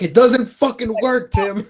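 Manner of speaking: loud, high-pitched mock rant at a coworker; mock exasperated outburst. Acting out a stressed techie in a meeting, mock exasperated.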